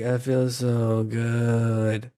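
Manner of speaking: slow low zombie drawl. Shown as a demonstration. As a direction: drag it out like a zombie. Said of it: "like a zombie noise".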